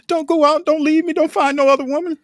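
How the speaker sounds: high, pleading mock girlfriend voice. Uncle mocking his girlfriend's pleas, high mock whine. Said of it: "She, she talking about that".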